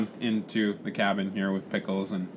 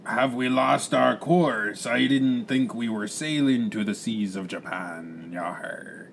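slow, rumbling pirate drawl; pirate captain; slower than usual. Acted. Captain worries about sea monsters, pirate drawl. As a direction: draw out the vowels like an old sea dog.

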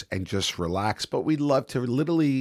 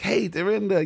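bright, high and breezy hello; cheerful high hello to his date; jovial greeting; 10 semitones higher than usual. Retold or imitated. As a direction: greet her like a carefree fun guy. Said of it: "very jovial".

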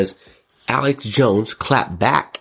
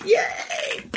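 excited shout; 4 dB quieter than usual. Real, not acted. Cheering, shout heard.

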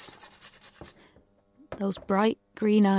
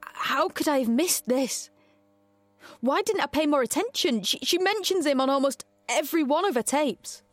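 higher-pitched, distressed disbelief; alarmed self-reproach; 6 semitones higher than usual. Acted. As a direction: berate yourself as the realization hits. Realizes a missed danger, self-reproach.

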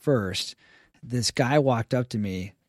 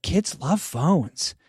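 imitating the painter; 6 semitones higher, much slower than usual. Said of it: "He's like".

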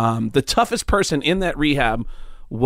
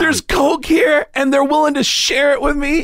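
thrilled; 12 semitones higher than usual. Retold or imitated. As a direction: savour it like a kid in a candy store. Thrilled recalling free coke.

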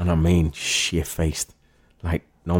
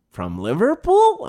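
high, slow incredulous question; mock a baffled producer; puzzled producer; slower than usual. Producer baffled by the Scouse idea, high slow disbelief.

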